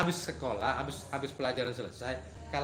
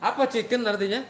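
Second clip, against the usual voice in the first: playful quiz; 6 semitones higher, 6 dB louder than usual. Real, not acted. Teacher quizzing the class.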